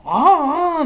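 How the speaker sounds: high, loud mock wail like an old man; frog's man-like call. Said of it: "みたいな".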